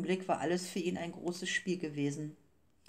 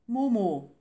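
loud, higher burst on the name; gasped out; 5 semitones higher, 9 dB louder than usual. A narrator's reading. Beppo bringing bad news to Gigi, gasping it out. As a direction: blurt it out, short of breath. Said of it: "stieß Beppo hervor, der nach Atem rang".

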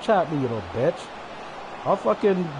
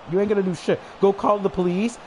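ordinary talk, nothing marked

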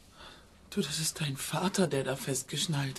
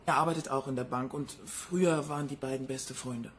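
ordinary talk, nothing marked

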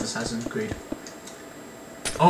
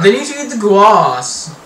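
hammed-up posh English accent, louder; mocking posh accent; 6 semitones higher, 9 dB louder than usual. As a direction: parrot him in a posh accent. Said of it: "Did you just hear yourself?".

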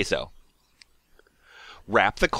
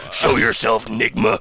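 stuffed-up, lower Batman voice; mock superhero growl, pitched down; 6 semitones lower than usual. Reader calls out the Riddler in stuffed-up Batman voice.

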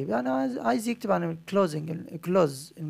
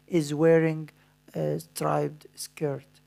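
dictating; much slower than usual. Shown as a demonstration. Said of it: "أنا دلوقتي همليك بعض الكلمات".

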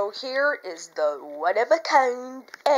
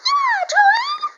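very high, squealing puppet voice; Eddie the Backpack; 8 dB louder, slower than usual. Pretend. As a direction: burst out in a squeaky, loud character voice. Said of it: "Eddie the Backpack, come to life".

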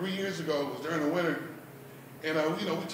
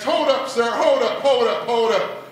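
loud, raised halting protest; urgent protest; 3 semitones higher, 6 dB louder, slower than usual. Acted. Stopping a stranger's chant, loudly objecting. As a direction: cut him off, louder and higher.

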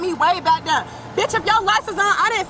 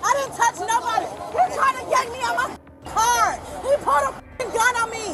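real upset protest; 6 semitones higher than usual. Real, not acted. Arrested woman protests to officers.

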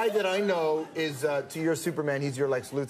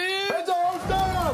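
excited high shout; 5 dB louder, much slower than usual. Real, not acted. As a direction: yell it like a challenge. Challenge called out.

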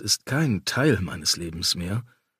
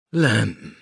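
mild, weary sigh; 4 dB louder than usual. A narrator's reading. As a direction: say the name on a sigh. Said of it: "seufzte Damien".

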